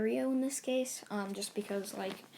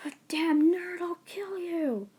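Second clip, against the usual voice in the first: higher, louder angry yell; furious threat; 9 semitones higher, 5 dB louder than usual. Acted. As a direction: spit out the threat in a rage. Rival charging in anger.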